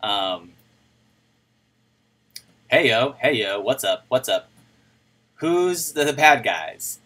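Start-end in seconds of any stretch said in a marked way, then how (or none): none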